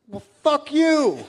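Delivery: high, loud, slow laughing fuck-you; laughing retort. Retold or imitated. Retorting in an argument.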